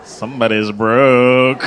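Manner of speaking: loud, slow, drawn-out tease; mock taunt. Comic teasing women without kids, slow mocking taunt. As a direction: stretch it out loudly to tease.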